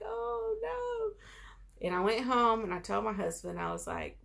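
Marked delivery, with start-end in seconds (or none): 0.0-1.9 much higher, tearful wail; crying oh no. Retold or imitated. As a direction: let it break into a sob, high. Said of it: "I cried".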